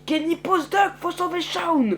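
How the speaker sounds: high, urgent shout; urgent rescue order. Acted. Urgent rescue order during attack.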